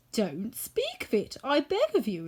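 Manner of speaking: modest, slow, a touch of a gentle tin man; lightly voiced woodman. A narrator's reading. Woodman to the mouse, a modest brush-off. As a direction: wave the thanks away kindly.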